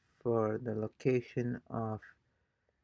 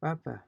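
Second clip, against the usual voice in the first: Papa as PA-pa, high then falling; Papa with an unstressed final A; stress on first syllable; 5 semitones higher than usual. Shown as a demonstration. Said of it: "which syllable has the main stress".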